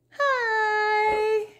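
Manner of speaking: bright high greeting; cheery hi. Acted. Narrator and pants greeting each other, high cheerful hi. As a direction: sing out a friendly hello.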